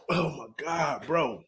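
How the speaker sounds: ordinary delivery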